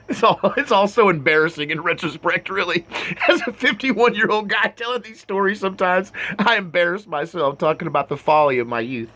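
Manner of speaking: sheepish, laughing confession; embarrassed laughter. Real, not acted. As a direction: laugh at your own folly while talking. Embarrassed laughing at own youth.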